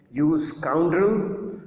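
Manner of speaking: slow, loud, drawn-out insult; angry shout. Retold or imitated. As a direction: spit out the insult slowly. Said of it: "What he shouted at him?".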